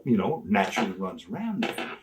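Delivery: normal talking voice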